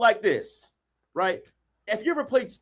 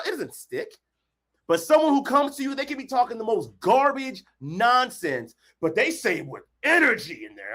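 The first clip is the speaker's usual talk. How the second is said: ordinary talk, nothing marked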